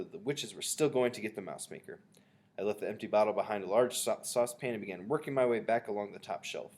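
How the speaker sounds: plain reading voice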